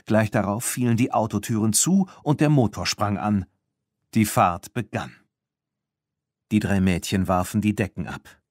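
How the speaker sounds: ordinary delivery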